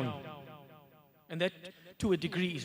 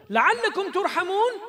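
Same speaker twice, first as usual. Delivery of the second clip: high, fast ringing recitation; raised recitation; 10 semitones higher, 8 dB louder, much faster than usual. Acted. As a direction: call it out quickly and high. Repeated verse, raised.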